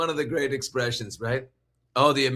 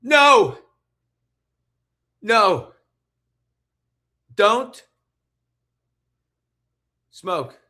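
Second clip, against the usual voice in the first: high, loud mock-horrified pleading; hammed-up shocked objection, easing off at the end; Linda's horrified reaction; 11 semitones higher, 10 dB louder, much slower than usual. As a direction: gasp and cry out each word in mock alarm, with long gaps. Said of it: "Linda's like".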